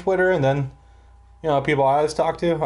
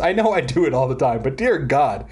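ordinary delivery

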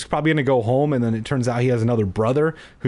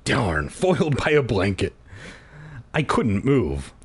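plain reading voice

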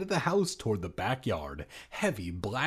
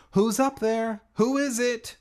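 higher, louder call up the stairs; shouting up; 10 semitones higher, 6 dB louder than usual. Acted. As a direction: yell up to the attic. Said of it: "shouted up the stairs".

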